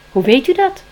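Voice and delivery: high, loud startled blurt. Acted. Spring blurts a question at the owl, startled and loud. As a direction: let it slip out in surprise. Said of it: "flapte Spring eruit".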